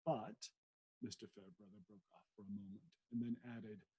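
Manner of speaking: plain reading voice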